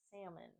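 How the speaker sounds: salmon softly as SAM-un, no L; majority US pronunciation. Shown as a demonstration. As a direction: say salmon with a silent L. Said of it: "for majority of the U S".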